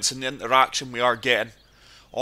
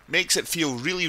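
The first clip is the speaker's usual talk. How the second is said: usual speaking voice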